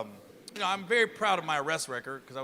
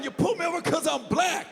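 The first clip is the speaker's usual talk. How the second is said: mock yelling driver; 10 semitones higher than usual. Driver shouting accusation at the officer, high yell. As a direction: hammer the accusation. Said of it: "kept yelling".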